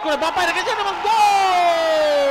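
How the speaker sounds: slow, loud, high drawn-out goal; goal call. Real, not acted. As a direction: stretch the goal call long. Fifth goal scored.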